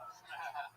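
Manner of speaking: short laugh. Real, not acted. Laughing at own joke.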